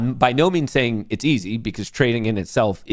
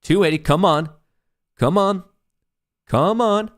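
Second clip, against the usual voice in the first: higher with each come on; excited urging; 7 semitones higher than usual. Real, not acted. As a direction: plead with the price to hit the target. Urging price to target.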